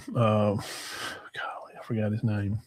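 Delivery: slow, breathy whisper while searching memory. Real, not acted. Host groping for the Bears quarterback's name, whispered. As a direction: murmur it under your breath, stalling to remember.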